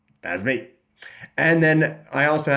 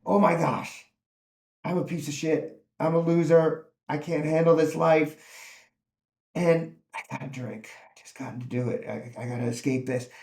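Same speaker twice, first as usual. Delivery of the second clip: despairing inner thoughts. Retold or imitated.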